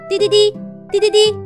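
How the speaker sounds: high fast beeping alarm. Acted. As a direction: chirp it like a siren. Alarm sound in story. (高声模仿警报)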